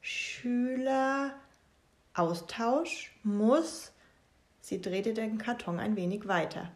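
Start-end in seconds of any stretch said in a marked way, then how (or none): 0.0-3.9 reading out word by word, very slowly; slow deciphering. Acted. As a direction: spell out the cheese letters slowly. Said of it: "Frieda las laut".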